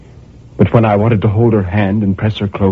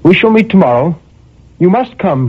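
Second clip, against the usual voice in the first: light high; 11 semitones higher, 4 dB louder than usual. A narrator's reading. Woman replies.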